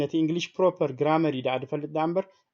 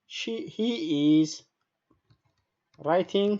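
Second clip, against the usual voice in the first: higher, broken into single words; slow dictation; 3 semitones higher, much slower than usual. Shown as a demonstration. Teacher writing out an example for students, halting dictation. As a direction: hold each word while writing it out.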